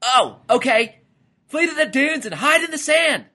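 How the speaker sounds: loud, very high cartoon command; high-pitched hollered order; frantic order. A narrator's reading. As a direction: order the family to run for cover. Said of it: "Lyle then ordered".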